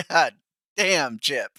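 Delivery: half-laugh, half-exclamation at the rant; laughing disbelief. Real, not acted. Streamer laughing at Chip's rant, amused disbelief.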